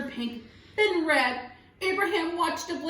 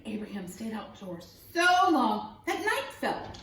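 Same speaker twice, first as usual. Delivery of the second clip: ordinary delivery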